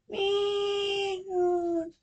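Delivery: long high drawn-out meow; a high cat cry before the pounce; cat screech. Acted. Cat-woman lunging at the officer, long high meow.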